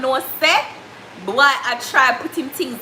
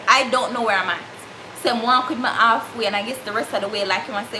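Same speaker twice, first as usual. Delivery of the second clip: ordinary talk, nothing marked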